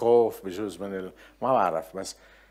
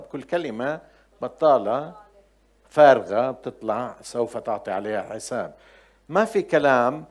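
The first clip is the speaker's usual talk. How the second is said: ordinary talk, nothing marked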